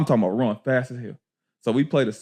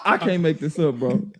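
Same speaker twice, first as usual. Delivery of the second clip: ordinary talk, nothing marked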